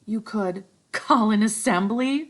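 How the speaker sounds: Piggy, tentative. Acted. Piggy voiced in story.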